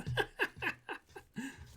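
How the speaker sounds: high staccato laugh; amused laughter at a cheeky message; real laugh. Real, not acted. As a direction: burst into quick high chuckles.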